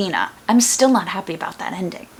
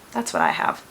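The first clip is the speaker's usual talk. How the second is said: deep mock voice; 12 semitones lower than usual. Mock confession about merch.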